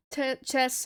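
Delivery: ordinary delivery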